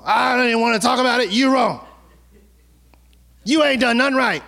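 fast, high, half-shouted accusation; a hammed-up petulant outburst; indignant shout. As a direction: snap back with a mock tantrum. Acted quarrel, the accuser shouts.

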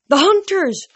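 high, loud cry of recognition; excited cry. A narrator's reading. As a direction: call out the hunters' arrival. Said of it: "Annabeth cried".

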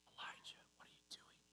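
unvoiced close whisper. God whispering to Elijah at the cave mouth, hushed whisper. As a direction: whisper it right next to his ear. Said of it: "The type of whisper".

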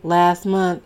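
ordinary delivery

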